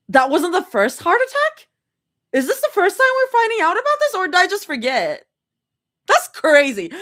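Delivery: incredulous, pitch climbing on the questions; shocked disbelief. Real, not acted. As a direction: react to a shocking plot twist. Reader shocked by plot news.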